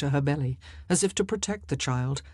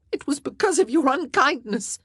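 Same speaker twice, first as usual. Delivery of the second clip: high, loud outburst; bursting out; 10 semitones higher, 5 dB louder than usual. A narrator's reading. Wife blames husband for the loss, bursting out. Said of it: "she burst out".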